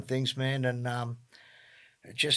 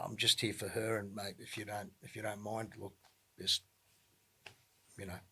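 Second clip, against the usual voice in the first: much softer, lower, halting brush-off; polite refusal; 5 semitones lower, 10 dB quieter than usual. Retold or imitated. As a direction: trail down quietly, with hesitant pauses. Politely declines flyer at the morgue.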